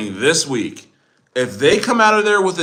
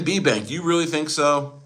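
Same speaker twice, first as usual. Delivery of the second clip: ordinary talk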